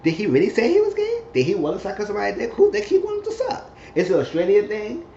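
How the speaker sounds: high-pitched, breezy mock questioning; imitating nonchalant friend. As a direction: mimic a friend firing off nosy questions in a high voice. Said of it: "very nonchalant and very, like, free".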